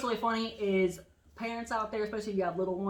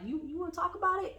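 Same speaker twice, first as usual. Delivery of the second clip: retold gentle asking; 10 semitones higher than usual. Friend asking if she wants to talk, high gentle voice. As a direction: imitate a tender, careful invitation to open up.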